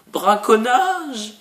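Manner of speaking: loud high drawn-out proclamation; booming shout. Pretend. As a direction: shout it out slowly, hammed up. Mock announcement of poaching.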